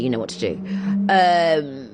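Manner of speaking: ordinary talk, nothing marked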